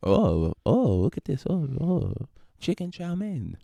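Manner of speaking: hammed-up Chinese restaurant worker accent; mock Chinese accent.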